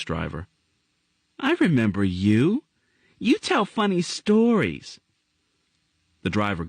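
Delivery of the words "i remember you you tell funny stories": put-on high, apologetic little girl; a scared girl pleading not to be scolded; young girl voice. Girl character voice.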